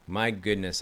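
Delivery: normal talking voice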